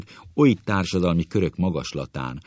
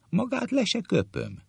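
high, sneering dismissal; scornful. Acted. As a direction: look down on him with a jeer. Scornful insult in a quarrel.

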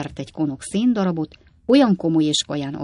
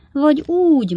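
high, loud moment of understanding; light up as it suddenly makes sense; higher, louder "oh, I see"; dawning realisation; 11 semitones higher, 8 dB louder than usual. Acted.

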